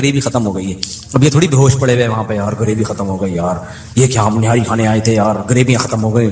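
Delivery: a light, sleepy put-on of overfed diners; drowsy diner. Preacher mimics overfed diners lying half asleep, sleepy mock voice. People dozing after eating nihari.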